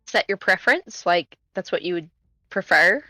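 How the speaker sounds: ordinary talk, nothing marked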